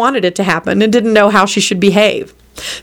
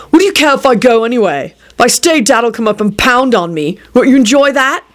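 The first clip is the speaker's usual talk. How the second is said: irritated, higher-pitched challenge; barked; 5 semitones higher than usual. A narrator's reading. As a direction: bark it back at a sibling. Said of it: "Jamie barked".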